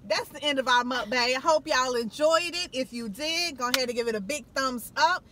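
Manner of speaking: loud, upbeat sign-off; vlog outro. Acted. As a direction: hype up the goodbye to viewers.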